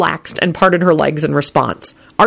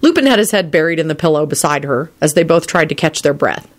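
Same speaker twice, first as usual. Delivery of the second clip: plain reading voice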